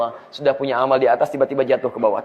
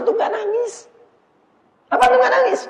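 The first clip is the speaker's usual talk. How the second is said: pleading cry. Real, not acted. Preacher pleading with congregation.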